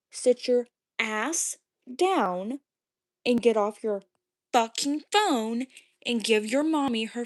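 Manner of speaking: loud, slow, word-by-word scolding; angry, belittling order. Real, not acted. Streamer ordering a viewer off as a child, angry. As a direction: lay it down loudly and slowly, talking down.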